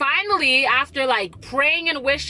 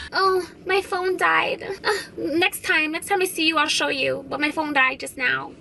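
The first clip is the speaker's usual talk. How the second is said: imitating whiny excuse; 4 semitones higher than usual. Friend dodging proof of the DM, whiny mock excuse. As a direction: play the friend squirming out of showing her phone. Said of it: "And then she goes".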